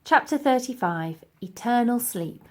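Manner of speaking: plain reading voice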